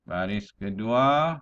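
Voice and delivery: usual speaking voice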